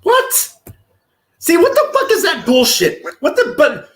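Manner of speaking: high, incredulous outburst; exasperated disbelief. Real, not acted. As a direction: react in high-pitched, indignant disbelief. Incredulous reaction to streamer's question.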